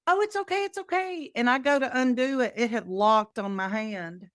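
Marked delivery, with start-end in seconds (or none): 0.1-1.3 reassuring. Retold or imitated. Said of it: "And I'm like".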